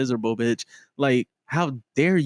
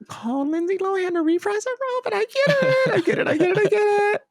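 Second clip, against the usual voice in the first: much higher, mock resigned; high squeaky aside. Real, not acted. Fan conceding about the video, squeaky high resignation.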